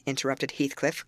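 ordinary delivery, nothing marked

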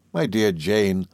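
usual speaking voice

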